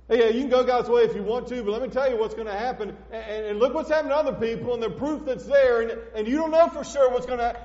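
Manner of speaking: the devil talking you out of God's way, loud; tempting Satan. Acted. Preacher voicing Satan to young people, loud and pushy.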